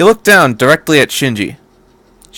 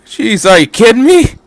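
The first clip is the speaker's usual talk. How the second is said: raised, loud scoff; 10 semitones higher, 5 dB louder than usual. A narrator's reading. Toji mocking Kensuke's praise, scoffing. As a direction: snort in disbelief. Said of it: "Toji snorted".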